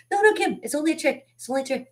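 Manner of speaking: high-pitched retold reassurance; imitated soothing adult. Adult calming a crying child in a story, high mock reassurance. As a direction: start very high and urgent, easing slightly as you reassure. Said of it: "to calm me back down".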